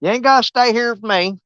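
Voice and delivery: ordinary delivery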